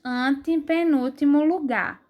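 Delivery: ordinary talk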